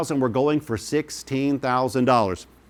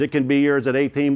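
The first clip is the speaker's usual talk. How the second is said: ordinary talk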